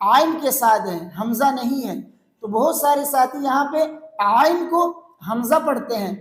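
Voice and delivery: normal talking voice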